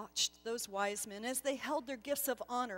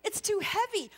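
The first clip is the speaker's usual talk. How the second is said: higher, louder anxious objection; protesting; 8 semitones higher, 6 dB louder than usual. Acted. Gasper protesting to the angel, flustered. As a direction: push back, flustered. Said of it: "But still he protested".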